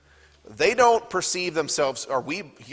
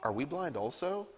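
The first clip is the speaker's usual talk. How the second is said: quiet, honest self-questioning; genuinely wondering aloud; self-aware question; 8 dB quieter than usual. Shown as a demonstration. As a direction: ask "Are we blind also?" softly, as a sincere check on oneself. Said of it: "it's a self-aware question".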